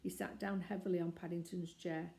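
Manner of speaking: plain reading voice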